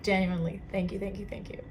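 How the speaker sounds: ordinary talk, nothing marked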